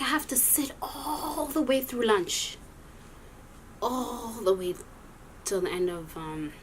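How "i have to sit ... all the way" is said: high-pitched whine with "all" stretched right out; slow, raised-pitch lament, long drawn "all"; exasperated complaint. Retold or imitated. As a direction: drag out "all" as if it lasts forever. Dismayed complaint about the long wait.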